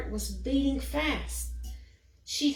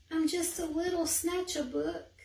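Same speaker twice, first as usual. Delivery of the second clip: mournful mumble; 7 semitones higher than usual. Acted. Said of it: "He mumbled with a mournful look".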